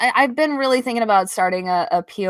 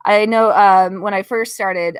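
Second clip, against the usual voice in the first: usual speaking voice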